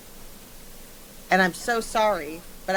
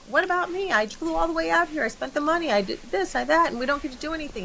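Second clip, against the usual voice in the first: whiny complaint pitched much higher, speeding up at the end; mocking whine; 8 semitones higher than usual. Friend teasing the girlfriend's complaints, high mocking whine. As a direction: tease her by whining in a high, self-pitying voice. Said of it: "I wanna tease this part".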